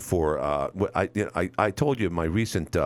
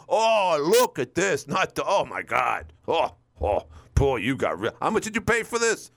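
Kevin Fear; 8 semitones higher than usual. Retold or imitated. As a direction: needle the host like a smug rival. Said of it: "Kevin Fear picks on me".

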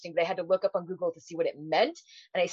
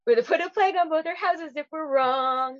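high, theatrical stage voice; hammed-up musical line; musical character; 9 semitones higher than usual. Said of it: "Reefer Madness, the musical".

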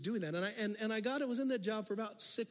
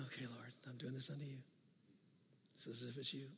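much softer, hushed prayer to the Lord; soft inner prayer; 4 semitones lower, 9 dB quieter than usual. Retold or imitated. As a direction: breathe it out like a private prayer. Quiet prayer remembered at work.

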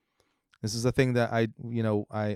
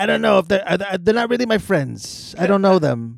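Trump impression; 9 semitones higher, 7 dB louder than usual. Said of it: "Trump impression continues".

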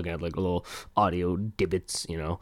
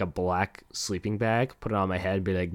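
ordinary talk

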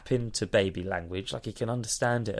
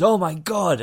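higher, louder mock shock; mock gasp; 8 semitones higher, 6 dB louder than usual. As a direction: gasp at the discovery. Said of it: "Elena Kinder goes".

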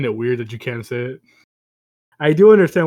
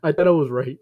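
ordinary delivery

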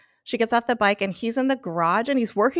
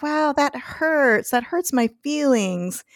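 slow, higher, wounded tone; mock hurt; 6 semitones higher, slower than usual. Retelling Patty's reply to Graham, slow mock-hurt. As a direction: play the stung wife, slowly.

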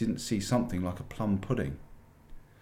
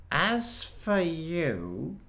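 cold witch; 9 semitones higher, much slower than usual. A narrator's reading. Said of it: "said the witch".

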